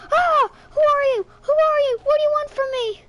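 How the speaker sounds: put-on high, loud frightened cry of a small girl; terrified little sister. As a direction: shriek it like a scared five-year-old who doesn't know you. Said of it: "And she said".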